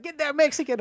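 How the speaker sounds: high, loud, goofy yell at a teammate; dumb gamer yelling. Retold or imitated. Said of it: "I was like".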